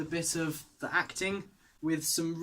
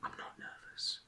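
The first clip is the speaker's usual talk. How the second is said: whispering so the rehearsal isn't disturbed; quiet backstage whisper update; 3 semitones higher, 8 dB quieter than usual. Real, not acted. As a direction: keep it to a hushed whisper backstage.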